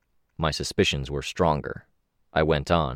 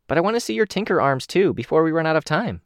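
higher, eager Kenzie voice; bright eager kid character, higher; 10 semitones higher than usual. Acted.

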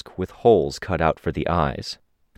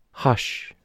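hushing; 7 semitones higher than usual. A narrator's reading. Nursery silences Snag with a hand over his face, hushing. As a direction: shush him gently, nearly all breath.

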